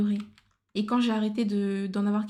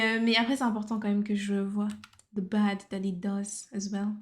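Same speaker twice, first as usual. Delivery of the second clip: normal talking voice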